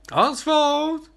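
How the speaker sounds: high, loud call across the house; shouted call. Retold or imitated. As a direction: yell the name out searching. Character calling missing companion.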